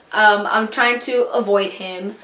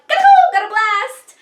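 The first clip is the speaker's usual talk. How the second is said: very high, silly escape cry; comic getaway squeal; 10 semitones higher than usual. Acted. Girl dashing to her ride, comic high squeal. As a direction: squeal it playfully as you dash off.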